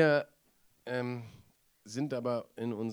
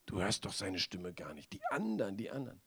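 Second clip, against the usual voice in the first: hushed tempter voice; 5 semitones lower, 8 dB quieter than usual. Retold or imitated. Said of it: "die gleiche Stimme sagt".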